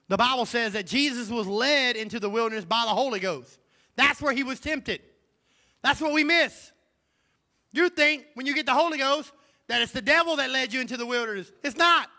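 loud, high, driving preacher cadence; preaching at full force. Acted. Pastor rousing the congregation, full-force preaching. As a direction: lift the church with every line.

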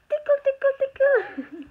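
high, loud tickle singsong; playful tickling. Real, not acted. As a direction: squeal it while tickling. Tickling the baby.

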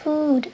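"food" said with a long English u; English word food. Shown as a demonstration. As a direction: model "food" clearly. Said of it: "vas a decir".

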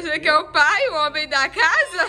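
loud, laughing challenge; loud words bubbling with laughter; laughing tease. Real, not acted. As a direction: tease him through open laughter. Teasing the father while laughing.

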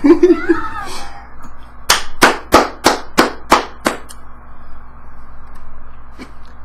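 long, breathless, high cackle; high wheezing laugh. Real, not acted. Long laugh after loss.

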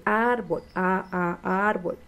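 short separate "a, a" before "árbol"; repeated initial "a" as phonics practice; sounding out árbol. Shown as a demonstration. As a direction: isolate the first vowel of "árbol", then say the word. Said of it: "Y ya él repite".

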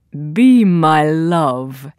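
low, slow princely voice; storybook prince. A narrator's reading. Prince proposing to Thumbelina, low slow voice. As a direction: drop low and draw it out as a prince.

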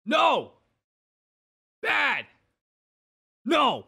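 high loud frantic no; frantic shouting. Real, not acted. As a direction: yell at it in alarm. Shouting at something in game.

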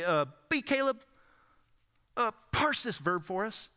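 put-on Southern accent, calling on the student by name; Southern drill sergeant. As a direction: put him on the spot, Southern professor style. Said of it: "he's like this drill sergeant".

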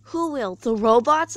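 worried, loud cry of alarm; a frightened child calling back about robots; concerned cry. A narrator's reading. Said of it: "Elizabeth cried back, concerned".

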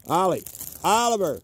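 high, loud falling calls; repeated urgent calls; calling the dogs. Real, not acted. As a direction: shout the names across the field. Calling lost dogs outdoors.

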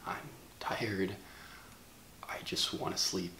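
barely above a whisper, drained and low; a worn-out near whisper, like he's about to break; hushed, tired confession. Acted. Hushed, ashamed apology to his viewers.

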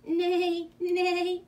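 high, loud drawn-out whinny in words; horse neighing. A narrator's reading. Reader voicing the horse for children, horse neighing. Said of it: "said the horse".

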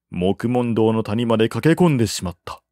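plain reading voice

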